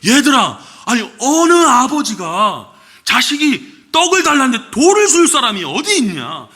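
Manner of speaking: a hint of an incredulous Jesus, pitch climbing; lightly voiced Jesus asking who would do such a thing; Jesus pleading. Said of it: "예수님께서 이렇게 얘기를 하시는 거예요".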